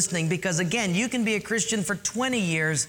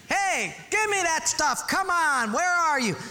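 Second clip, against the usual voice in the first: pitched-up lazy couch husband calling out; preacher hams up a beer-belly husband; mock yelling husband; 10 semitones higher than usual. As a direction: holler from the armchair. Husband voiced in joke story.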